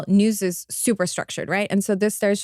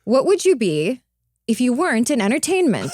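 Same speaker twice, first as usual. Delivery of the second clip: punchy TV voiceover question; slightly lifted anchor read; tracking voice; 3 semitones higher than usual. Shown as a demonstration. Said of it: "So I'll read, like, a question".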